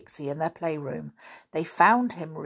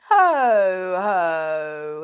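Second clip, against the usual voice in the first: wizard's ho-ho; 7 dB louder than usual. A narrator's reading. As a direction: boom it out like a storybook wizard. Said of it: "he said".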